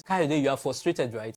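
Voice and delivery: ordinary talk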